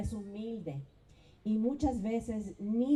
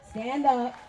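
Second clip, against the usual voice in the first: louder, raised-pitch call to stand; 4 semitones higher, 9 dB louder than usual. Real, not acted. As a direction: call it out across the crowd. Calls staff members to stand.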